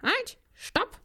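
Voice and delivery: high squeaky mock cry for help; noodle shouting. The noodle begs the policeman not to eat it, high and squeaky. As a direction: squeal at him to stop. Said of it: "schreit die Nudel".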